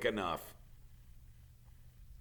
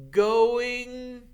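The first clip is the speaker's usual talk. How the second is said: high drawn out; 9 dB louder than usual. A narrator's reading. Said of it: "fading sequence".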